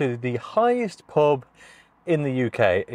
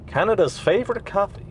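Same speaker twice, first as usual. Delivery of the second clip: higher, botched Canadian accent; failed Canadian accent; 8 semitones higher than usual. Shown as a demonstration. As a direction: try a Canadian accent and miss. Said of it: "That was not a Canadian accent".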